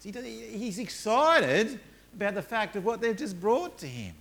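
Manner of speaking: high, lively excited telling. Acted. Preacher describing Paul's joy, high and lively. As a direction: share his delight.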